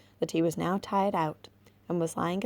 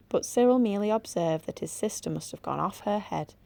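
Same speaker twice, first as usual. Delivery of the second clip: ordinary delivery, nothing marked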